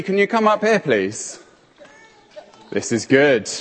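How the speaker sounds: usual speaking voice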